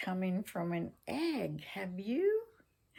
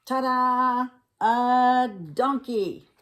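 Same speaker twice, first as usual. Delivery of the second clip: loud, drawn-out ta-da; showman reveal; 6 semitones higher, 8 dB louder, slower than usual. Acted. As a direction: present it like a magician. Fox unveils egg.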